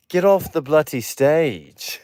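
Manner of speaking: slow mock British dismissal; hammed-up British judge; Simon Cowell. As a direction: order them off in put-on British. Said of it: "Simon Cowell's like".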